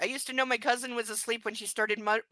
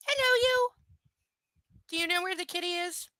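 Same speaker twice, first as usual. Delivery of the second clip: pet talk; 8 semitones higher than usual. Real, not acted. Owner greets the new puppy, high baby talk. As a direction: coo at a puppy.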